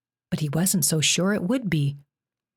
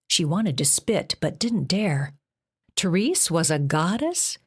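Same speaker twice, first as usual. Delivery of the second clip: ordinary delivery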